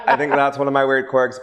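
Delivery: ordinary talk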